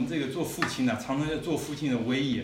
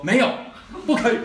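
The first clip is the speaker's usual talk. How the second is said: 高声斩钉截铁地拒绝; 硬邦邦一口回绝; stern loud refusal; 11 semitones higher, 11 dB louder, faster than usual. Retold or imitated. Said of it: "你知道我怎么说". (父亲高声断然拒绝孩子)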